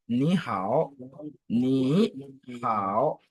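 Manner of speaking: NI and HAO spoken as careful syllables; tone demo. Shown as a demonstration. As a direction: spell out the tones on NI HAO. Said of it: "ça reste toujours troisième ton".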